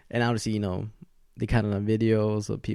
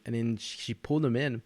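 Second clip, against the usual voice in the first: ordinary delivery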